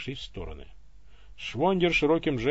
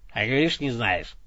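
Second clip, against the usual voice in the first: smug; 3 semitones lower than usual. A narrator's reading. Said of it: "победно ухмыльнулся Иван".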